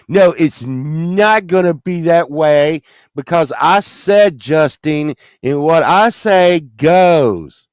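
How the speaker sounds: loud, drawn-out insistence; defiant outburst. Pretend. Man overruling his fate, defiant and emphatic. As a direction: lay down the law, stretching the key words. Mock tirade laying down the law.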